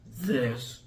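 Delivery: low, terse brooding imitation; Athos. Athos explaining loss, low brooding voice. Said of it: "character with all the deepness".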